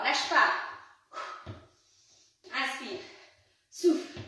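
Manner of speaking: loud breathing cues to the class; pumped-up fitness coach. Acted. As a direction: keep them moving and breathing. Coach pushing through the exercise.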